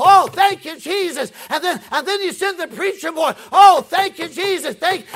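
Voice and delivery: slow, loud, wailing prayer; fervent prayer. Retold or imitated. Converted woman praying aloud in her kitchen, shouting. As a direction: cry out to the Lord at full volume. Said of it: "and she could pray".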